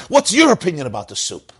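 high-pitched mock challenge; acted, worked-up question; heated demand. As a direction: demand a verdict mid-quarrel. Husband demands verdict amid staged fight.

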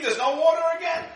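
high, fast, loud complaining cry; hammed-up yell of a thirsty crowd; mock screaming. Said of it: "They're screaming".

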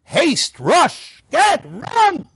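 high, urgent single-word bursts; sharp high cries, each word punched out; urgent rush. Shown as a demonstration. Preacher showing what fleeing in haste is like, urgent. Listing words for fleeing Egypt.

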